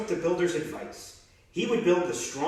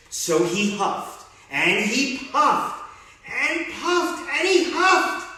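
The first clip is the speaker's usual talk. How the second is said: slow, rising, louder narration; building up; 11 semitones higher, 6 dB louder, much slower than usual. A narrator's reading. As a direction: build up the huffing. Wolf huffing and puffing.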